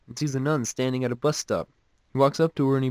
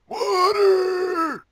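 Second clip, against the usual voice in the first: very high, loud, stretched-out croak; strained high squawk, drawn out; croaking fish; 4 dB louder than usual. Shown as a demonstration. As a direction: demonstrate a gasping fish's croak. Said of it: "like, croak".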